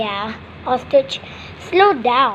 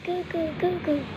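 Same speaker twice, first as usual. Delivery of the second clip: put-on cooing baby; 12 dB quieter than usual. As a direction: coo like a pretend newborn. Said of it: "That baby just came".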